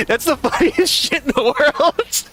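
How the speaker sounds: high-pitched laughter breaking through the words; giggling at the joke; laughing. Real, not acted. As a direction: crack up mid-sentence. Laughing at his own joke.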